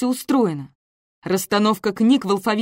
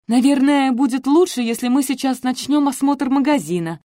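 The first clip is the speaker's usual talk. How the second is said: decisive; 6 semitones higher than usual. A narrator's reading. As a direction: cut the topic off decisively. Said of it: "решительно сказала Мартиник".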